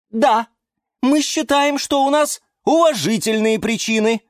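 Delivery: defiant. A narrator's reading. Plants defend themselves.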